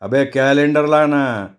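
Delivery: ordinary delivery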